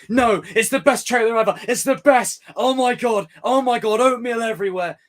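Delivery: high, loud mock fan gushing; gushing fanboy. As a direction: ham up a screaming superfan. Mocking overhyped trailer fans.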